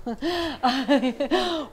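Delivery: high, loud burst of laughter; real hearty laugh. Real, not acted. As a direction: laugh at the silly default. Laughs at own example.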